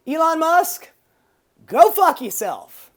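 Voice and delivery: punchy comic blast at a billionaire; angry outburst. Acted. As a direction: bark it at Musk like a heckler. Telling off Elon Musk.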